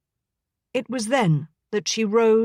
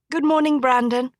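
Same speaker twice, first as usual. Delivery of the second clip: pitched-up happy hello; brightly; 5 semitones higher than usual. Acted. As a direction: greet him with high, bright delight. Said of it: "she said brightly".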